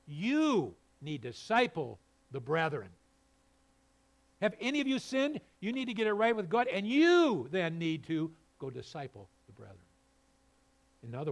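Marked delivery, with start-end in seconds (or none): 0.1-9.8 loud, high exhortation to the congregation; preaching at full force. Acted. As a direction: drive the call home, rising.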